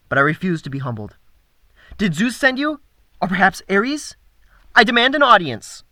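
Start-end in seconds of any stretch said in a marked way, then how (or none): 2.0-5.8 high, loud imperious demand; haughty god voice. Acted. Apollo demands an audience.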